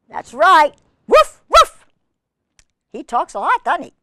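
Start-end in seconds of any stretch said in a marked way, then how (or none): 1.1-1.9 high, loud double woof; puppy woof. Acted. Biscuit answering the reader, high loud puppy woofs. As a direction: yip like an eager little puppy. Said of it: "Biscuit says".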